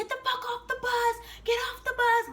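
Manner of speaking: very high, strained mock yell; imitated sergeants yelling. Drill sergeants banging on bus windows, high mock yell. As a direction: yell it high without full volume.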